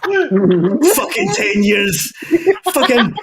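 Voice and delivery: slow, high, drawn-out laughing moan; laughing disbelief. Real, not acted. Mocking a friend who waits ten years, slow laughing disbelief.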